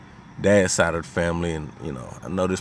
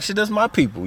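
much higher, hammed-up "that's my people"; delighted realization; 12 semitones higher than usual. Finding out a schoolmate is family, high and delighted. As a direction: act out the happy shock of kinship.

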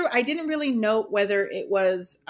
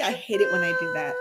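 ordinary talk

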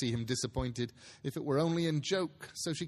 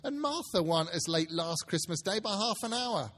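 a mother asking where everyone is; Mrs Cratchit's voice; 7 semitones higher than usual. Acted. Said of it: "said Missus Cratchit".

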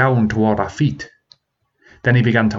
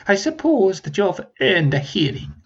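higher dragon voice, grudging; conceding dragon; 9 semitones higher than usual. Acted. Dragon grudgingly granting the humans a hearing. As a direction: give in with a sneer. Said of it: "he conceded".

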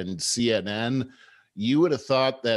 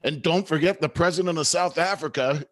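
interjecting politician; 6 semitones higher than usual. Retold or imitated. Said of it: "pipes in and says".

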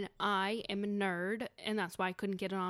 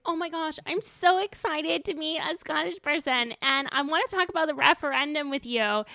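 higher, louder eager fan babble; gushing excitement; 6 semitones higher, 7 dB louder than usual. Retold or imitated. Nerd meeting an actual Scottish person, gushing excitedly. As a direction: gush at someone you're thrilled to meet. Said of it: "so I was super excited".

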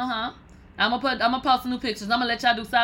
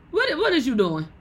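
normal talking voice

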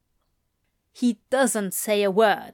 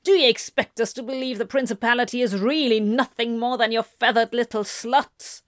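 rapid, put-on voice of accusation; accusing demon duke; 2 semitones higher, faster than usual. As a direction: turn on him with a quick, contemptuous challenge. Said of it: "the Duke abruptly turns on him".

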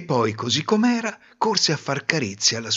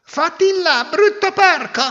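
much higher, louder scolding cry; disgusted shove-off; 6 dB louder than usual. Acted. Bride shoving off the dirty pig prince, high and loud. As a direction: drive the filthy pig away with disgust. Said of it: "lo scacciò dicendo".

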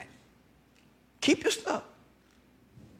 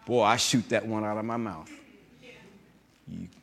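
ordinary talk

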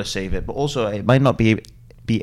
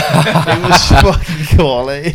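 higher, slow words through laughter; laughing; 7 semitones higher, 7 dB louder than usual. Real, not acted. Cracking up while retelling a friend's voice note, laughing. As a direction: crack up as you say it.

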